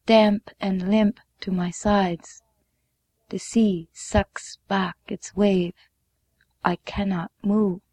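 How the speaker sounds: usual speaking voice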